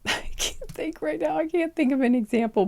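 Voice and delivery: real laugh breaking into the words, high; high, flustered laughing speech. Real, not acted. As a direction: giggle helplessly while talking. Giggling at own blank mind.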